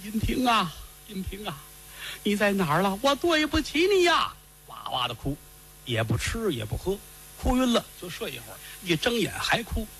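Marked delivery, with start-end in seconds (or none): 0.0-4.3 tearful wailing. Acted. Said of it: "坐在这儿掉眼泪儿".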